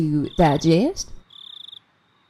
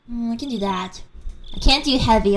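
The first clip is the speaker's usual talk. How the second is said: ordinary talk, nothing marked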